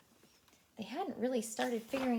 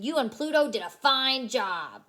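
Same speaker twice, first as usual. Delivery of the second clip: policeman; 2 semitones higher, 6 dB louder than usual. Acted. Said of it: "said the policeman".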